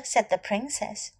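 ordinary delivery, nothing marked

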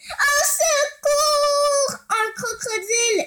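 drawn-out, high, loud cry for help; terrified scream. Acted. Lou fleeing the crocodile, screaming for help in panic. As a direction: wail in panic as the jaws snap. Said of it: "Hurla Lou".

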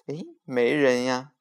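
usual speaking voice

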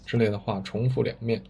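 usual speaking voice

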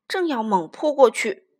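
ordinary delivery

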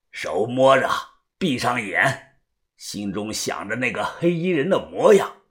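low, quiet instructing elder; old fortune-teller. Acted. Old diviner instructs the narrator before divining, old fortune-teller. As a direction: guide them softly in an old man's low voice.

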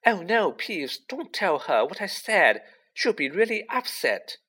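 high, voiced frantic plea; frantic whisper. A narrator's reading. Girl begs poltergeist not to tell, frantic. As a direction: plead in a panic, pitched high. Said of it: "Hermione whispered frantically".

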